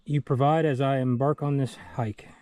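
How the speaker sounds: loud, earnest prayer. Acted. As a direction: pray aloud with fervor. Spoken prayer for food and water.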